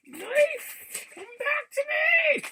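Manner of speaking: high, loud hammed-up shout for the knife; calling out. Vlogger hunting for her lost knife, hammed-up loud call. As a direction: yell to it like a lost pet.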